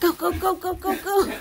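giggling "go, go, go" chant; laughing cheer. Real, not acted. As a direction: cheer them on through giggles.

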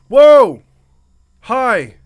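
loud startled yelp; startled shout. Real, not acted. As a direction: jump at the scare, yelling. Jump scare.

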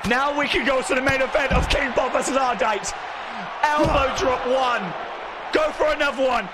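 pumped-up announcer calling the main event; hyped wrestling announcer. Acted. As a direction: shout out every move like a ringside commentator. Wrestling match play-by-play commentary.

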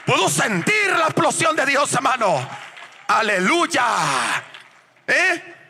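high, shouted revival preaching; preaching at full force. Acted. Preacher exhorting the church to praise, full-force shouting. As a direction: fire up the crowd with blessings.

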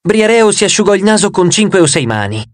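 ordinary delivery, nothing marked

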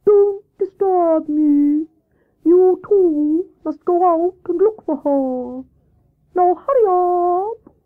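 high, slow, loud hooting voice; commanding Wise Owl. Acted. Owl orders Hare and Squirrel, slow commanding hoots. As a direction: give orders in long-drawn hoots. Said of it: "commanded the owl".

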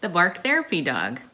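put-on loud, bright presenter opening for children; storytime host intro. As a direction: welcome the little ones like a kids' show host. Opening the story time show.

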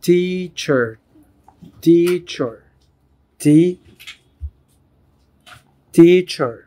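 teacher repeated, broken into tea-cher; syllable drill. Shown as a demonstration. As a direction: clap the two beats of teacher. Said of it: "two syllable word". (تقطيع teacher لمقطعين)

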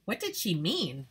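ordinary delivery

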